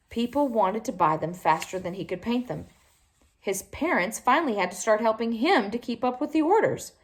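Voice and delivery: usual speaking voice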